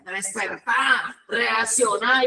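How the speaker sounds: slow, drawn-out mock command to wake up; a hint of the friend slapping sense into her; lightly voiced stern friend; snap out of it. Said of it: "la cachetea".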